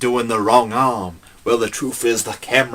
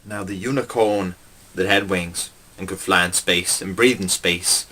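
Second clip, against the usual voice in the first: normal talking voice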